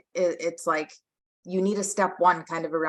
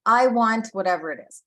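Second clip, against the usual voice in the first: imitating toddler demand; 5 semitones higher, 5 dB louder than usual. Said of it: "he's like".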